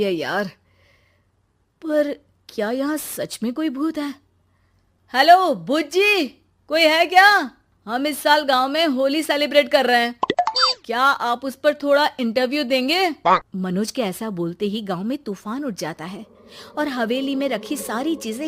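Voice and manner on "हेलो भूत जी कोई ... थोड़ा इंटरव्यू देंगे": calling out loud. Acted. Boy calling out to ghost.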